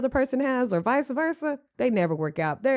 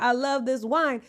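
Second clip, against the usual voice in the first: ordinary talk, nothing marked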